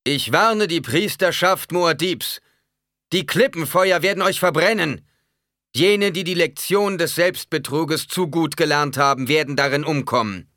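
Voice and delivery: loud, high, ringing warning; stentorian shout. A narrator's reading. Preacher warns the priesthood, stentorian shout. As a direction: cry it out over a whole crowd. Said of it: "rief mit Stentor-Stimme aus".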